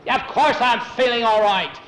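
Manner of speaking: loud, high-pitched indignant retort; irritated retort. A narrator's reading. Tom answers the steward who asked after him, irritated retort. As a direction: snap back indignantly.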